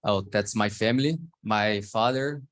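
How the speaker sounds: ordinary talk, nothing marked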